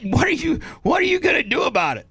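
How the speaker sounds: high, fast taunting dare; hammed-up high voice of a man picking a fight; mock tough-guy challenge. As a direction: squeak out the threat like a puffed-up bully. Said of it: "he said".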